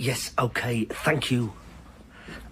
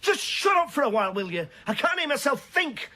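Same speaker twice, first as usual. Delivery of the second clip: exasperated outburst; 12 semitones higher than usual. Acted. Man yelling at his nagging companion, exasperated. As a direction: shut up, high and sharp.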